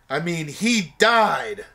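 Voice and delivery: slow, heavy punchline delivery; dramatic slow reveal. Acted. Host ending the fake plot, slow and heavy. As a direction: drag out the final word.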